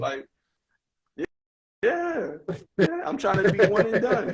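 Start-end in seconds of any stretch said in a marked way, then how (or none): 1.8-4.3 much higher, laughing through a jokey player voice; laughing mock voice. Mocking juco teammates who want out, said higher through laughter. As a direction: play a cocky juco kid, cracking up.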